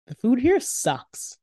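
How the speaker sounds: put-on high, slow kid-brother gripe; complaining little brother. A narrator's reading. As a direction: grumble like a kid about the food.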